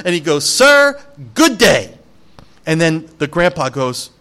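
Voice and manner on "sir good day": loud, curt brush-off; mock Wonka slamming the door on them; stern dismissal. Preacher retelling Wonka dismissing grandpa, curt and loud.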